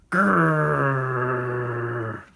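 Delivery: low rolled growl; dog growl. A narrator's reading. Reading Mister Barker's growl, low and rumbling. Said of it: "spelled Mister Barker's growl".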